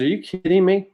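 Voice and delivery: high, incredulous burst. Retold or imitated. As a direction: pitch it up in disbelief. Urging his old teammate.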